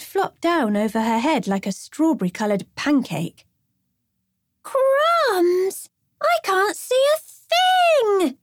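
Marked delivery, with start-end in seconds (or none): 4.6-8.4 put-on high, slow girl's panicked cry; girlish yell. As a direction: squeal it out from under the cape. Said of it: "she yelled".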